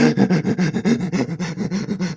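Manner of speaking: high, stuttering fake sobs; mock breathless sobbing. Man imitating how he cried when little, mock breathless sobs. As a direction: sob in short gasps, as if unable to breathe. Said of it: "that stage where you couldn't breathe".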